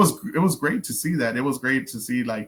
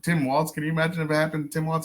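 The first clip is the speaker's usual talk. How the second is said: usual speaking voice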